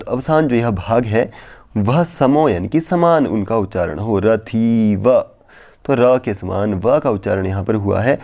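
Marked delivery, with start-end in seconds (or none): 4.2-5.2 रथीव said very slowly, the vowel held and wavering; trembling drawn-out vowel. Shown as a demonstration. Teacher demonstrating the kampa tremor on रथीव, very slow. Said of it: "इस तरह से कांपता हुआ इसका उच्चारण हो".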